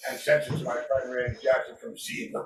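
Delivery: distant, off-mic voice; speaking away from the microphone; turned away from mic. Real, not acted. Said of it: "you're off mic now".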